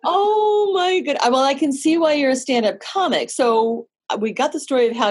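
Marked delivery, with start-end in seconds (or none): 0.0-1.2 high, slow laughing agreement. Real, not acted. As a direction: laugh through the words. Reacts to joke, laughs.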